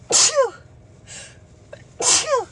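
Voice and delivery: loud high sneezes; sneezing. Real, not acted. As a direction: sneeze hard, again and again. Sneezing fit continues.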